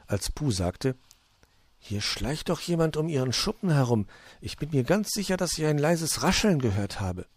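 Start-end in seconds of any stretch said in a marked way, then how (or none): 1.8-7.3 higher storybook voice, lilting upward; Puh, wary. A narrator's reading. Puh warning of someone prowling, wary and high. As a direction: voice a wary character pointing out a noise.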